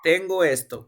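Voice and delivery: usual speaking voice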